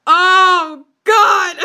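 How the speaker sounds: loud groaning through real laughter; helpless, embarrassed laughing; laughing despair. Real, not acted. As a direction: groan and crack up at how bad it is. Reader laughing at own bad writing.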